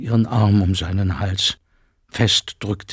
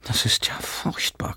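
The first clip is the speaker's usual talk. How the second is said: horrified whisper, almost no voice; mother appalled, whispering her lament; 3 semitones higher, 6 dB quieter than usual. Acted. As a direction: breathe it out in dismay. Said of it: "klagte sie".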